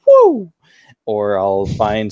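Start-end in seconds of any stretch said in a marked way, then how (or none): 0.1-0.5 very high, loud whoop; excited whoop. Retold or imitated. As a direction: let out a wild cheer. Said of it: "I go".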